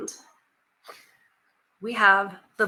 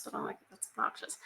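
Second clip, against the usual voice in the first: whispered aside; 16 dB quieter, faster than usual. Retold or imitated. Self-correcting a slang word aside.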